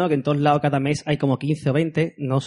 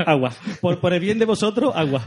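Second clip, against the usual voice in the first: laughing; 4 semitones higher than usual. Real, not acted. Laughs while insisting.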